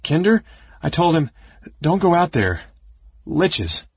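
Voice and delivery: high, confused rambling; babbling. A narrator's reading. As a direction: babble in broken bursts. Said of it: "Caramon was babbling".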